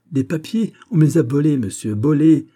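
high, loud, exasperated retort; indignant outburst. Acted. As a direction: protest in outrage at the question. Tripov protests papers were stolen. (riposte indignée, aiguë et forte)

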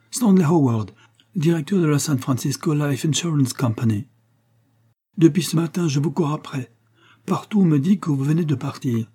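high, breathless pleading; panicked plea. Acted. Insurance director begging the armed chief not to shoot, high panicked plea. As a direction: blurt it out, afraid of the gun. Man fearing being shot.

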